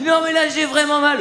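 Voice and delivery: put-on high, whining child pleading; hammed-up whiny son claiming it hurts; mock whiny child. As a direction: play the kid faking pain.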